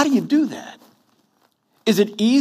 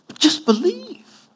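high, slow, pleading "just believe"; 12 semitones higher, slower than usual. Retold or imitated. Jesus urging Martha, a high slow plea. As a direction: coax her gently to believe. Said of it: "He said".

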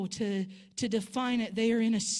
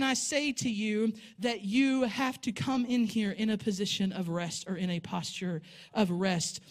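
ordinary talk

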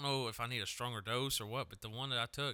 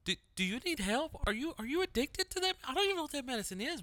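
squeaky, much higher mock panic; alarmed coworker; 3 dB louder than usual. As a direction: play the shocked coworker, pitched way up. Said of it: "And they go".

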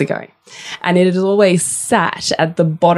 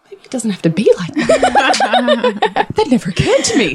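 laughing realisation; 9 semitones higher than usual. Real, not acted.

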